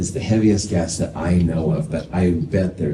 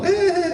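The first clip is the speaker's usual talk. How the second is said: put-on kid crying whine. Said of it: "and start crying".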